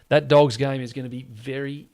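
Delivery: usual speaking voice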